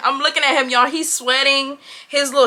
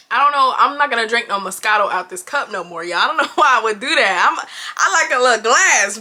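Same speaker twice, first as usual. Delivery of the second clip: normal talking voice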